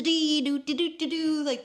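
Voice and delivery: high, singsong doo-doo-doo; clownish show tune. Retold or imitated. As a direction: imitate someone putting on a silly show. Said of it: "trying to make me laugh".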